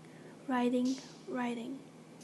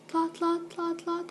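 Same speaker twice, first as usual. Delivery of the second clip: higher, level-pitched clip-clop chant; imitated hoofbeats; 4 semitones higher, slower than usual. Hoofbeats reaching the waiting girl, imitated on a higher note. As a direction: beat out the hooves on a raised note. Imitating approaching horse hooves.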